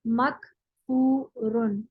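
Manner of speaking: मकफूरुन said again very slowly, each syllable stretched; slow drawn-out repeat. Shown as a demonstration. Teacher repeating मकफूरुन for students, very slowly. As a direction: spell मकफूरुन out slowly for the class. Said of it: "इनकार किया हुआ".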